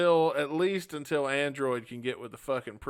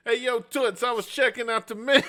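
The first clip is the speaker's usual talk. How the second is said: put-on high, loud New York Italian accent, a bit fast; mock New York accent; 9 semitones higher, 4 dB louder than usual. Tourist answering an Italian waitress in a hammed-up New York accent. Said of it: "you look at her and go".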